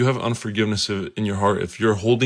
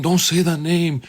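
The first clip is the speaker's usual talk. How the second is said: demon voice; 7 semitones higher than usual. Retold or imitated. Demon shouting down the prayer, higher and firmer. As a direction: push the warning up high, demanding. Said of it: "the demon starts speaking".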